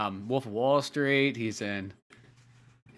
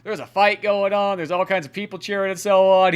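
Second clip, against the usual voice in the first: higher, louder mock-clueless chatter; oblivious; 5 semitones higher, 5 dB louder than usual. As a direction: chatter on, blissfully unaware. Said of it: "like, oblivious".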